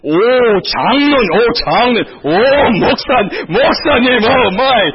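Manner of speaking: high, loud fawning greeting; mock awed deference. Church member greeting elder and pastor, hammed-up awe.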